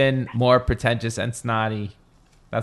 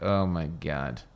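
low, slow, quiet disdain; disdainful reaction; 7 semitones lower, 8 dB quieter, much slower than usual. Retold or imitated. Host watching Jersey Shore, low disgusted disbelief. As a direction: mutter it slowly, looking down on them. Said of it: "looking at those guys like".

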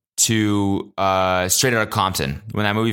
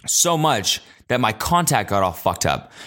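ordinary talk

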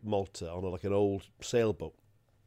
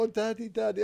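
mock crying child; 13 semitones higher than usual. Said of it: "the kid's crying".

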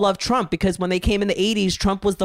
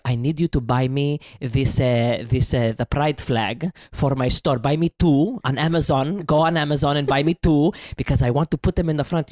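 put-on Greek accent; 5 semitones lower than usual. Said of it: "he's like".

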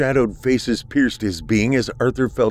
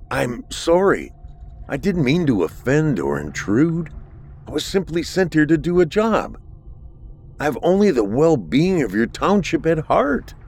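higher, pleading and anxious; 8 semitones higher than usual. A narrator's reading. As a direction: beg to be believed. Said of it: "Arthur pleaded".

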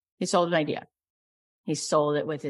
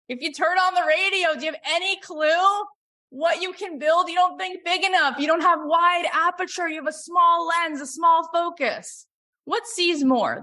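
high, insistent, urging the listeners; impassioned exhortation; 10 semitones higher, 3 dB louder than usual. Real, not acted. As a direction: drive each point home at high pitch.

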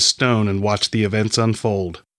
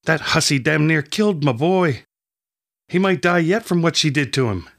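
furious, pitched higher; shaking with anger; 7 semitones higher than usual. Acted. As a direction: spit out the accusation in anger. Angry villager accuses the girl.

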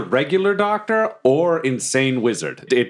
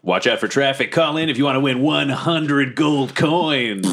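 radio DJ voice; 3 semitones higher than usual. Pretend. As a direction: sell it like a radio promo. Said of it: "Sound like a radio D J".

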